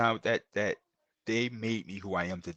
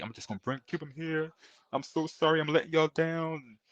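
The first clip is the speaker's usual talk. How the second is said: retold crying apology; 7 semitones higher than usual. Father apologizing to his mentor, close to tears. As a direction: let the voice go small and near tears. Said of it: "Crying, bro".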